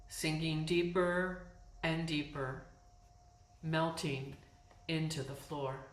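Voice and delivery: calm, unhurried meditation voice with long pauses; guided yoga nidra. Real, not acted. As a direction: guide them into letting go, phrase by phrase. Leads yoga nidra practice.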